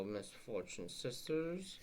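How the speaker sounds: ordinary delivery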